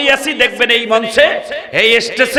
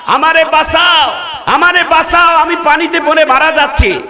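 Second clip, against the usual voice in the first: high, raised cry for rescue; imitated urgent begging; drowning man's plea; 6 semitones higher than usual. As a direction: call out pleadingly as a retold Pharaoh. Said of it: "ষাট বারের অধিক বলছিলেন".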